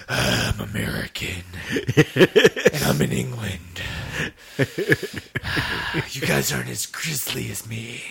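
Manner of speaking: hammed-up, drawn-out imitation with breathy pauses; Matthew McConaughey impression. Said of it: "Matthew McConaughey's like".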